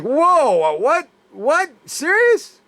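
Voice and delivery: high, rising squeaky disbelief; mock amazed, pitch leaping way up; retold astonishment. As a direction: voice shooting up in incredulous questions. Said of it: "I told Lynn when she said".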